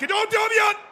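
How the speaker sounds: loud, high anguished shout. Real, not acted. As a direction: cry out at the missed chance. Big chance missed.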